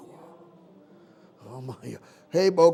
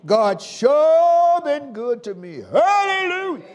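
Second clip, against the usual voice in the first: louder, slow, ringing praise; slow rising praise cry to the church; preaching at full force; 7 semitones higher, 5 dB louder than usual. Real, not acted.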